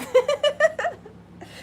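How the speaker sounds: high-pitched burst of laughter; squealing laugh at a joke. Real, not acted. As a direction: cackle at your own joke. Joking about blaming Amy.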